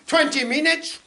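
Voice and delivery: loud call after someone walking away; shouted reply over a distance. Retold or imitated. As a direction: yell it to a man striding off. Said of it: "he shouted after me".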